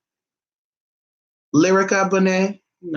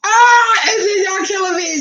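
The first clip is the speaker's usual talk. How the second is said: sudden high yelp, then squealing the rest; excited outburst; 4 dB louder than usual. Real, not acted. Host yelping at viewers' comments, excited. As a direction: cry out, tickled by the chat.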